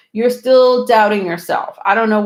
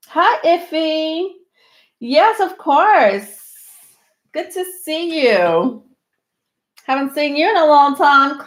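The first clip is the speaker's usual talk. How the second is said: happy greeting; 6 semitones higher than usual. Real, not acted. Greeting a returning viewer warmly.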